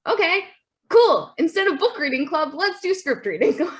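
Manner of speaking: high, loud burst of agreeable enthusiasm; hammed-up can-do cheer; mock upbeat reply. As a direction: pitch it way up, brightly proposing a fix. Acting out the committee's upbeat answer.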